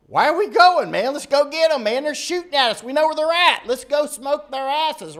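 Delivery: loud, high, fired-up shouting; eager battle yell. Retold or imitated. Soldier yelling at his unit to go get the enemy, fired-up shout. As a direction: urge the squad forward, hungry to fight. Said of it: "I mean, I was often like".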